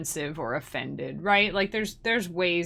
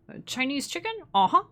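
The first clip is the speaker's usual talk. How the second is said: order-taker imitation; 7 semitones higher, faster than usual. Restaurant worker repeating a customer's order, high questioning imitation. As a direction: repeat the order back, lifting the end. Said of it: "they always repeat your order".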